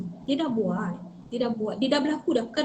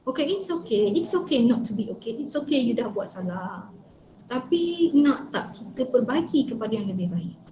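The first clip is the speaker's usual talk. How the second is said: soft, slowing, reassuring voice; gentle acceptance; 2 semitones lower, 3 dB quieter than usual. Acted. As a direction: comfort them as if giving a hug. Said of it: "So you kena peluk dia". (lembut memujuk dan menenangkan)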